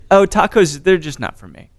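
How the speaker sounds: normal talking voice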